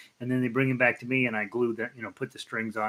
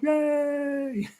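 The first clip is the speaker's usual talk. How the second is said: excited cheer. Real, not acted. Cheering a viewer's first grandchild.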